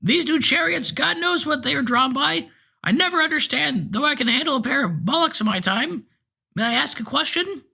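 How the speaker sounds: possessed driver insists he is fine, thin and piping. A narrator's reading. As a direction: pipe it out like a frail eighty-year-old. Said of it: "in a thin, piping voice".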